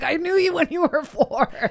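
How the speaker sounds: high, excited and starstruck. Retold or imitated. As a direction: gush like a starstruck fan. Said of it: "I was like".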